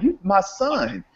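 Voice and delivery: high, strained voicing of a father's grief; a hint of a broken father crying out; imitating a father's anguish; grieving father. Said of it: "to see a man lose it".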